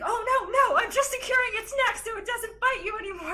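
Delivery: very high, hurried retold explanation; hasty reassurance. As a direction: imitate someone rushing to clear up a misunderstanding, voice way up. Randy hurriedly reassures the horrified teller.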